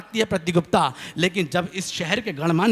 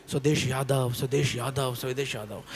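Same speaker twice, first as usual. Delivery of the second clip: mock echo; 7 semitones lower, 7 dB quieter than usual. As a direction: repeat the name lower and softer each time, fading away. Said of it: "आवाज़ लौट के आ गई". (धीमी होती गूँज की नकल)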